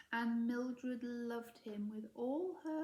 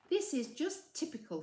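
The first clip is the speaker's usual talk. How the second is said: put-on higher, louder, fed-up girl's grumble; Mildred's complaint; 8 semitones higher, 5 dB louder than usual. A narrator's reading. Mildred grumbling to herself about the weather. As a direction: whine it like a put-upon schoolgirl.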